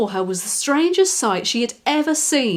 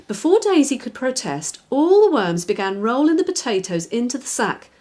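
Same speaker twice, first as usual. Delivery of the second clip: ordinary delivery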